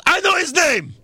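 very high wrestler's holler; imitated yell. Host acting Rusev answering the crowd's chant, high yell. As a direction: holler it in a high, mocking impression. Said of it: "and Rusev yells".

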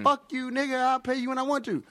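high defiant yell; cracked-out guy voice. Retold or imitated. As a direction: toss it back defiantly. Voicing cracked-out debtor.

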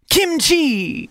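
big rising announcement of the name; grand introduction. Real, not acted. Host announcing her star guest in a grand voice.

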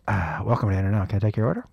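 low, deadpan fast-food greeter; drive-thru worker. Pretend. Host playing drive-thru person for guest, drive-thru worker. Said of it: "I'm gonna be the drive-thru person".

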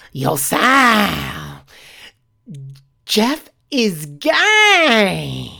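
drawn-out taunt to a classmate; student calling out. Retold or imitated. Student calls out in hallway.

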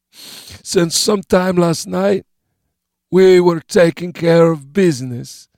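high, slow, smiling voice; smiling sick man. A narrator's reading. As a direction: say it with a grin. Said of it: "He smiled when he said it".

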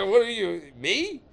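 high incredulous laughing squeak; mock disbelief. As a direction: act baffled that anyone would approach you. Stunned at being approached.

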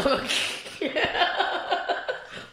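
high breathless giggling fit; laughing. Real, not acted. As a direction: burst out laughing mid-story. Laughing mid-story.

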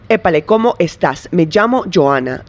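"Épale, ¿cómo estás?" with every s pronounced crisply, "estás" ending in a clear s; careful neutral Spanish, robotic and precise, every final s sounded; neutral Spanish diction. Shown as a demonstration. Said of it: "I sound like a frickin' computer".

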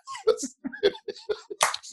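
squeaky high-pitched laugh; high giggle. Real, not acted. Laughing while telling a friend about a golf cart ride.

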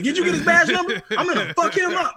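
hammed-up angry dad yelling; furious dad. Friend's dad on the phone about insurance, loud furious shout. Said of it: "He was pissed".

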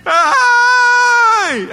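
high, loud shout from afar; excited castaway hollering from a distance; calling out. Retold or imitated. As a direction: yell across the beach at him. Islander yelling from a distance.

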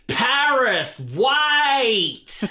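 high, drawn-out broad Australian yell; broad Australian accent. Retold or imitated. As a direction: holler it in exaggerated broad Australian. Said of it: "in the broadest Australian accent".

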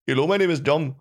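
high goofy dumb-fish voice; dopey cartoon fish introducing himself; Chum. Trying another take for a fish audition, goofy voice. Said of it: "He's kind of this goofy, dumb chum".

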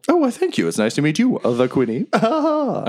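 high squeaky voice ending in a chuckle; delighted little creature laughing; cheerful badger.

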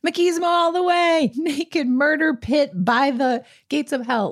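gleefully embrace the machismo, hammed up; mock gleeful film.

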